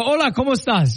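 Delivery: "Hola, ¿cómo estás?" sung out high and bright; eager high greeting. Retold or imitated. Greeting the cashier with a high, overeager tourist hello. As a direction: overdo the friendly tourist greeting. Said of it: "and I go".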